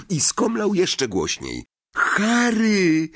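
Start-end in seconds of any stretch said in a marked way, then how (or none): 2.0-3.1 loud, much higher call of a name; a boy yelling to his friend, voice breaking with relief; shout with relief. Acted. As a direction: cry out the name in sudden relief. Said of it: "krzyknął Ron".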